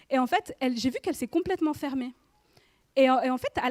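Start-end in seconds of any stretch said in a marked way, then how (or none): none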